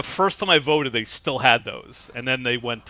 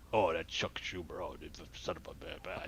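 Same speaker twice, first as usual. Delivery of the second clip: mumbling; 9 semitones lower, 16 dB quieter than usual. Retold or imitated. Old voter cursing a candidate at his ballot, mumbling. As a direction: lower and much softer, grumbling mumble. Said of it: "he's mumbling".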